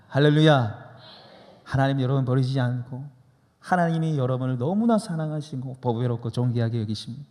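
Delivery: ordinary talk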